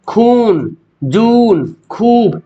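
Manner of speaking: खून, जून, खूब each with a long, held "oo"; drawn-out vowel. Shown as a demonstration. Teacher listing example words, खून, जून, खूब stretched out. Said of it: "जिसको खींच कर".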